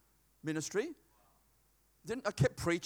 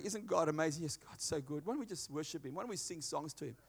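hushed awe; 6 semitones lower, 10 dB quieter than usual. Acted. Prisoners voiced worshipping.

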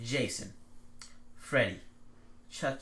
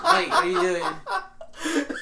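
slow laughing question, pitched way up; 11 dB louder, much slower than usual. Retold or imitated. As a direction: laugh through the words. Laughing while retelling a friend.

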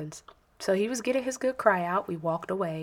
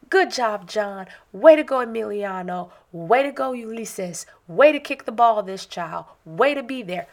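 sideline cheering; 3 semitones higher, 7 dB louder than usual. Retold or imitated. Mom cheering kids from sideline.

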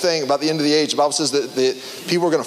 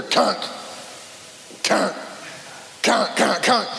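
imitated shovel clunk; 4 dB quieter, slower than usual. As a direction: mimic a shovel hitting a box. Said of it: "you hear".

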